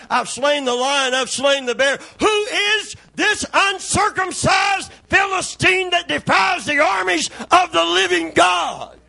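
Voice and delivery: David defies the giant, shouting; defiant shout. Retold or imitated. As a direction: rise into a bold battle cry. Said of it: "And he said".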